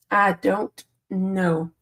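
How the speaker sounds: slow, stretched-out "I don't know"; slowly drawn-out "I don't know"; drawn-out resigned. Real, not acted. Repeats the phrase a second time.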